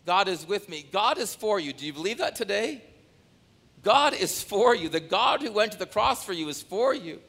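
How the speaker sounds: preacher building to a loud declaration; rising preaching. Acted. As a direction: build it up with conviction.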